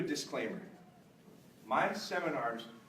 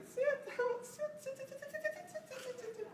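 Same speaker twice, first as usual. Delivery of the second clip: silly high sit; 7 dB quieter than usual. Shown as a demonstration. Trainer showing the crowd a silly high command. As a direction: chant sit playfully high so it means nothing. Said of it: "If I said".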